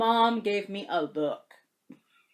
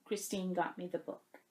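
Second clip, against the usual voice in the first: much softer, voice catching; choked up; 4 semitones lower, 13 dB quieter than usual. Real, not acted. Vlogger revealing a friend's gift, choked up. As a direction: say it barely holding back tears. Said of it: "I'm actually tearing up here".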